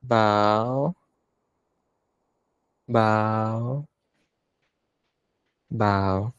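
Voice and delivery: long, drawn-out intoned call; ceremonial bow call. Real, not acted. Monk leading everyone in bows after the chant, intoned. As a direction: stretch the single word, unhurried and held.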